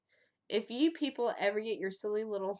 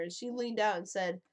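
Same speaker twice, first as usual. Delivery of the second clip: ordinary talk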